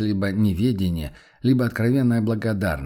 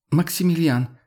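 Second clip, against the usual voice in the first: high, sharp warning call; 8 semitones higher than usual. A narrator's reading. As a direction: call his name out in warning. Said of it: "остерегающе окликнула Амалия".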